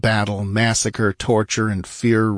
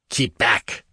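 ordered; 2 semitones higher than usual. A narrator's reading. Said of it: "ordered Shah Amurath".

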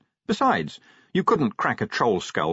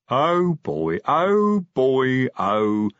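drawn-out, higher troll voice; troll stuck mid-phrase; hesitant troll; 6 semitones higher, 5 dB louder, much slower than usual. A narrator's reading. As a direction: stretch it out, searching for the word. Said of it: "Detritus hesitated".

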